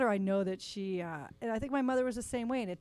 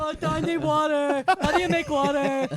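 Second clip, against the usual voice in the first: whiny helpless son; 6 semitones higher, 10 dB louder than usual. Retold or imitated. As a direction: play the clueless helpless son. Mom imitates helpless son.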